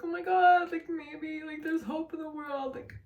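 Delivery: hopeful rush. Retold or imitated. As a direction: replay the moment the pain vanished, high and dreamy. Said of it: "feel like".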